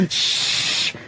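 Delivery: imitating Patrice shushing a crowd. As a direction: shush a whole subway car. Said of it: "and he'd just go".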